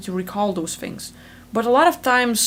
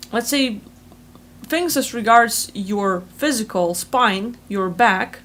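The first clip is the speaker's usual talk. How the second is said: ordinary talk, nothing marked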